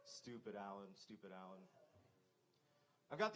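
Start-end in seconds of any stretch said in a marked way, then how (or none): 0.1-1.6 muttered to himself, much softer and lower; muttering at himself after flubbing a joke; self-scolding mutter. Real, not acted. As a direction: scold yourself under your breath. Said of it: "I feel like an idiot".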